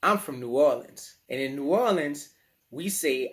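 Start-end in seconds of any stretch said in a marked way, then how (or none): none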